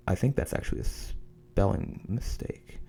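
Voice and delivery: hushed murmur to herself; a quiet under-the-breath remark; whispered aside. Real, not acted. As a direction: drop to a whisper for a side comment. Muttered aside while reading.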